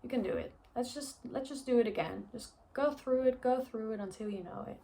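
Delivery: a hint of a patient mother urging her on; encouraging mom. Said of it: "And she'd be like".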